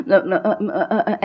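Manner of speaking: stuck on broken, repeated sounds; stammering demo. Shown as a demonstration. Showing students how one freezes on stage, stammering. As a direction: stammer on a single sound, unable to get the word out. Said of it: "ऐसे करने लगूँ".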